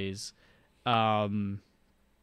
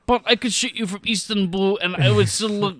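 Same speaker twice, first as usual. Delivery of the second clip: higher, louder put-on Kemper accent, smug and unbothered; Doctor Kemper's accent; 7 semitones higher, 3 dB louder than usual. Imitating the assassin's accent as he boasts to Bond. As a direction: play the assassin's accent, pleased with himself. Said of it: "[name] really does the accent just like him".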